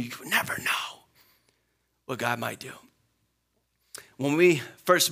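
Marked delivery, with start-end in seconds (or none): 0.0-2.7 whispered, breathy warning to the congregation; hushed warning. Real, not acted. Pastor warns his congregation in a hushed whisper. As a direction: drop to a hushed whisper, leaning in close.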